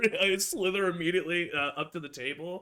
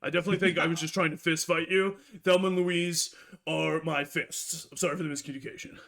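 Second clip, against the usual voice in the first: rushed apology; 3 semitones lower, faster than usual. Acted. Rogue backpedals apologizing.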